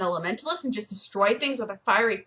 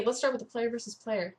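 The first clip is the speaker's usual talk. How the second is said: ordinary delivery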